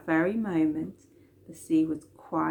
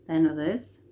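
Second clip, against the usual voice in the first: plain reading voice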